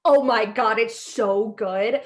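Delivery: slow, drawn-out gush. Real, not acted. Telling her friend how good the podcast is, gushing. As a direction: savour each word, delighted.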